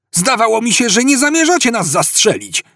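high-pitched shouted complaint; yell. A narrator's reading. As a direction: yell your objection across to the gunmen. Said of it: "wrzasnął". (wykrzyczana pretensja, wysokim głosem)

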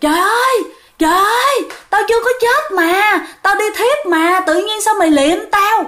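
shrill-pitched mock outcry of a woman who woke in her coffin; mock wailing shout.

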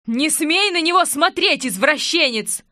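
high, loud scolding; jealous woman lashing out; jealous hiss. A narrator's reading. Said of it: "зашипела ревнивая блондинка".

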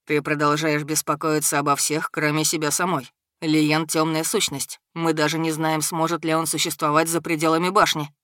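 cool, commanding dragon; stern dragon lord. Acted. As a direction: reprove evenly, pitched a little down.

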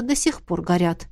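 plain reading voice

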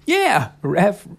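put-on bouncy cheerful kid brother; Mikey voice. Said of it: "And Mikey".

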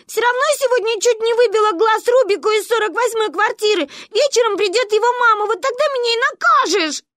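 very high, loud, rapid protest; yelling. A narrator's reading. Sister being dragged off to be punished, yelling. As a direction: holler frantically against the punishment. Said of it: "орала сестра".

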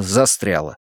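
ordinary delivery, nothing marked

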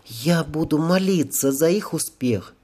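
put-on high, earnest girl's voice; devout girl angel. A narrator's reading. Angel vows to pray for the men, high earnest voice. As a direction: pitch it up as a young angel promising prayer.

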